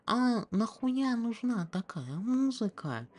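mock audience. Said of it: "А зрители такие".

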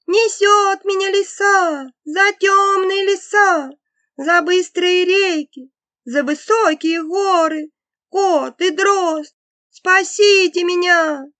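high, loud desperate cry; cry for help. A narrator's reading. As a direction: scream for rescue, pitch up high. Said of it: "Закричал петушок".

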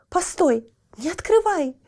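высокий испуганный крик; кричат, чтобы остановить; закричали. A narrator's reading. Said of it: "закричали Метель, Сахар и Хлеб". (друзья испуганно кричат Тильтилю, высоко)

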